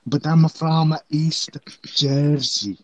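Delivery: mock East Jersey accent. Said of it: "This is the East Jersey accent".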